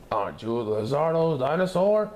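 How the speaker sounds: policeman. A narrator's reading. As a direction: question him like a cop. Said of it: "policeman stops Bob".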